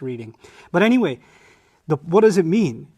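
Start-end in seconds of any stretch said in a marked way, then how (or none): none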